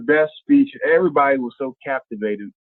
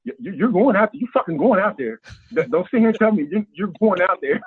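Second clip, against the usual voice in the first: higher, emphatic retold order; insistent; 8 semitones higher than usual. Friend ordering a nervous speaker out on stage, insistent. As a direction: push him onstage, repeating yourself with rising urgency.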